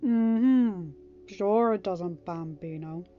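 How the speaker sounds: teasing. A narrator's reading. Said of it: "Tony teased".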